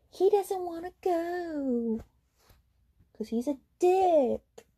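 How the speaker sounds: mocking drawl. Real, not acted. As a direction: draw it out with scorn. Mocking Blake.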